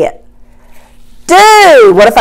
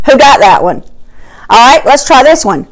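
ordinary delivery

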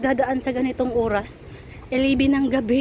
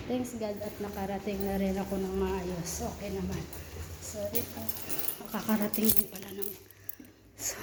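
very soft late-night talk; hushed; 9 dB quieter than usual. Real, not acted. Everyone asleep, late at night.

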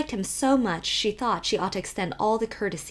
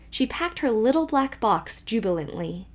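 usual speaking voice

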